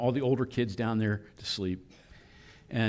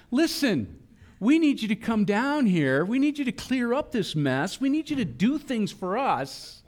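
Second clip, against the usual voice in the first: put-on much higher, slightly louder pushy kid voice; mock childish demand; 11 semitones higher, 3 dB louder than usual. People ordering God to come fix things, mock childish demand. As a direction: whine like a kid ordering a parent around.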